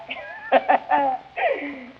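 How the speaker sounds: high rolling laugh at own joke; hearty laugh. Acted. Comic laughing at his own wedding story.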